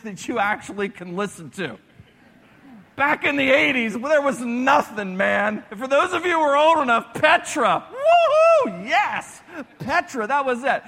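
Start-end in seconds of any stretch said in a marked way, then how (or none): 2.9-7.9 loud, fast and high excitement; excited. Real, not acted. Excited about eighties Petra. 7.9-8.9 high, loud woohoo cheer. Real, not acted. 8.9-10.8 loud, fast and high excitement; excited. Real, not acted. Excited about eighties Petra.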